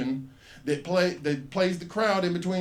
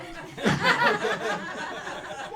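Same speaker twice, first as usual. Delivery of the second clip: high unvoiced squeal of laughter; shrieking laugh. Real, not acted. Comic shrieking with laughter at the phone intrusion.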